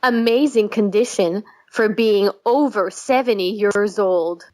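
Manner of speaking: ordinary talk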